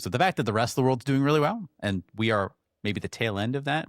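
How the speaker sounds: ordinary delivery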